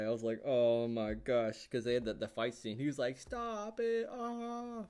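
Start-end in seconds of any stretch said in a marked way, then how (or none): 0.4-1.7 loud, drawn-out mock disbelief; hammed-up fan dismay, slow and loud; retold dismay. Acts out dismay at show. 3.2-4.8 high, drawn-out mock wail; hammed-up cry of a man under attack; imitated protest. Imitates character in fight scene.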